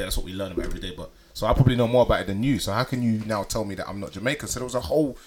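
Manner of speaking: normal talking voice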